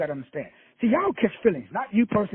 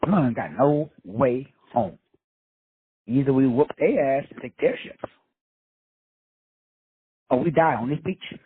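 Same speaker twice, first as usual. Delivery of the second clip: calm captain; 5 semitones lower than usual. Acted. Captain tells his panicking soldiers there's no way home, low and steady. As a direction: speak it like a leader with no retreat left.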